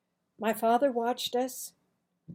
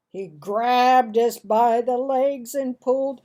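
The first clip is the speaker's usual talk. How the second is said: ordinary delivery, nothing marked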